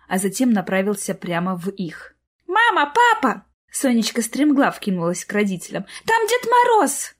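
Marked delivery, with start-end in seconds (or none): 2.5-3.4 put-on high-pitched little girl's excited call; squealed summons; excited child calling. A narrator's reading. Child calls excitedly to parents. 6.0-7.1 put-on high, slow, drawn-out child's cry; squealing with excitement; excited child announcing. A narrator's reading. Child announces Santa to parents.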